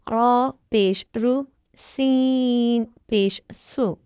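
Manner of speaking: slow sing-song spelling of each letter; slow syllable drill. Shown as a demonstration. Tajweed teacher drilling students syllable by syllable. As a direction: spell out each syllable slowly, like a Quran class.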